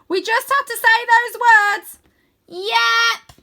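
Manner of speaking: put-on high, slow and loud goofy boy; calling down in a big high cartoon voice; bright playful wizard-boy voice; Wyn's voice. A narrator's reading. Wyn insists.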